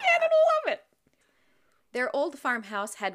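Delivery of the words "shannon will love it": squealed high and loud, over the top; a hammed-up delighted squeal; mock squeal. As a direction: gush it like a thrilled fan. Echoing in a squealed voice.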